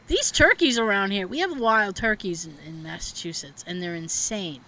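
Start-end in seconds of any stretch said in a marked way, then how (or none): none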